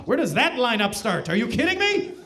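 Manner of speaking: exaggerated, high-rising incredulous questions; mock eagerness. Canadian asking where the lineup starts, mock-thrilled. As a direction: pitch leaping up in disbelief. Acted eager reply to insult.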